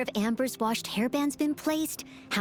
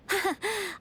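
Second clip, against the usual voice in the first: little laugh; 6 semitones higher than usual. Acted. Laughs before teasing line.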